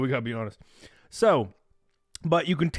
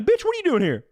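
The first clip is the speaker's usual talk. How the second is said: high, loud comic indignation; mock outrage; 13 semitones higher, 5 dB louder than usual. Man challenging his ex-wife in the afterlife, mock outrage. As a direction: confront the ex in disbelief.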